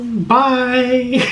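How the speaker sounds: bright laughing farewell; cheery laughing sign-off; laughing goodbye. Real, not acted.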